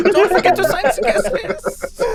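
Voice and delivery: high, giggling farewell to guests; a mock-cheery host through laughter; laughing host.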